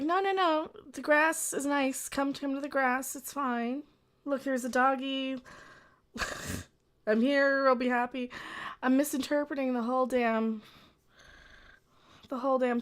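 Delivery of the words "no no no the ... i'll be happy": high, sing-song coaxing. Retold or imitated. High mock-coaxing voice luring a woman onto the grass. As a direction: lure them in like a pet.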